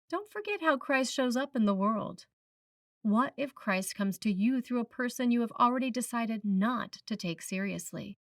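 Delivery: normal talking voice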